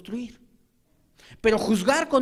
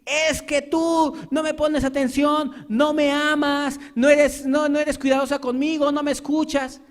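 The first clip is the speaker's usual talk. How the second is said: a higher, slow, whiny husband's complaint; complaining husband; 6 semitones higher, 4 dB louder than usual. Acted. Preacher playing a husband who accuses his wife, whiny and high. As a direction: plead like a hurt husband who feels ignored.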